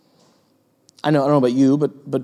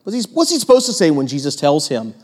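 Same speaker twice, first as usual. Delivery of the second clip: ordinary talk, nothing marked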